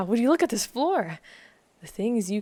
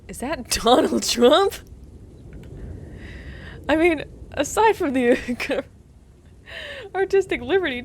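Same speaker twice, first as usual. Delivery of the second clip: laughing; 7 semitones higher, 3 dB louder than usual. Real, not acted. Laughing at Trump statue.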